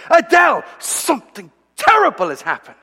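hammed-up high, loud cry of alarm; dramatic announcement. Husband bursts into bedroom to announce news, dramatic. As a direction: burst in with an over-dramatic shout. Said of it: "I'm going to be dramatic".

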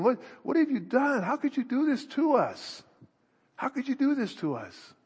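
anguished mother. Retold or imitated. Voicing Mary's anguished search.

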